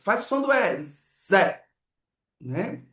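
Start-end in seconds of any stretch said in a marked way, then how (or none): 0.8-1.6 buzzed "Z" running into open "é" in "Zé"; stretched, seamless "Zé"; joined sounds. Shown as a demonstration. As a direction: blend a long zzz straight into "é". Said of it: "emendar com a".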